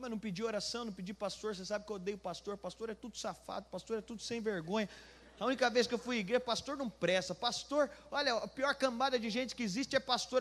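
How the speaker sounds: normal talking voice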